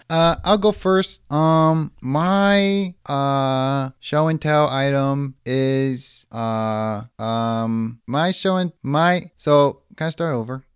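put-on nervous kid at show and tell; kindergartner voice. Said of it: "a kindergartner presenting to the class".

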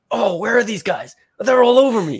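high, loud, panicked pilot voice; a flustered pilot calling out under fire; Windmill's voice. Retold or imitated. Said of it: "as Windmill's voice".

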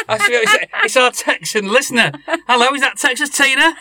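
high, bright mock phone-in host greeting; radio host voice. Host greets a pretend Texan caller, hammed-up radio voice. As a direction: play a cheesy radio host taking a caller.

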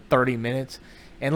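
ordinary delivery

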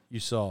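quietly voiced teacher correcting to saw. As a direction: gently correct the word saw. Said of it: "She's like".

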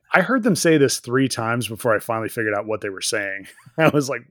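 usual speaking voice

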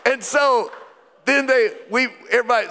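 normal talking voice

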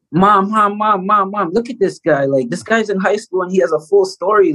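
high, insistent calls to mom; retold eager kid getting mom's attention; excited kid. Young player shows mom a guy on TV, excited.

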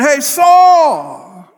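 high loud shout with a cry in it. Retold or imitated. David shouting to Saul from the cliff, loud cry. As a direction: cry his name out across the gorge. Calling across the gorge to Saul.

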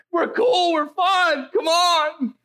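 hammed-up plea to a teen; high, laughing mock plea; mock pleading.

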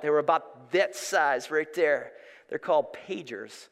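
ordinary talk, nothing marked

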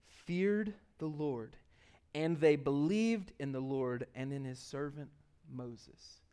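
slow, weighted Bible reading; solemn scripture reading. Acted. Preacher reading verses to the congregation, solemn and slow. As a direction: read it out slowly, pausing between phrases. Reads scripture aloud slowly.

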